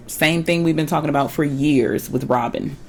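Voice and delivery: ordinary talk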